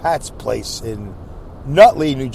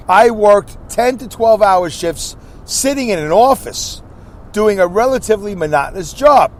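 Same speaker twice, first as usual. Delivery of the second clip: reading comment aloud; 3 semitones higher than usual. Acted. Host reading a listener's comment to the audience, slow and emphatic. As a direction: read the comment out with weight, slowly.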